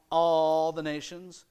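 all the nations said slowly, "all" drawn out and loud with a rise; stress on all. Shown as a demonstration. Preacher stressing to the congregation that "all" means everybody, slow and loud. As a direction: lean hard and slow on "all". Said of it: "I'm gonna emphasize that word "all".

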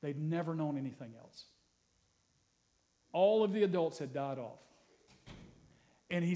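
ordinary talk, nothing marked

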